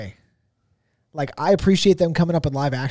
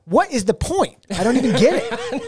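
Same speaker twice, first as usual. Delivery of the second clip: raised pitch, emphatic repeat of the protest; mock exasperation; 9 semitones higher than usual. Fan still not getting a Sebastian-less remake, exasperated. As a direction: hammer home the baffled complaint.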